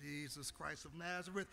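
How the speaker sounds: ordinary delivery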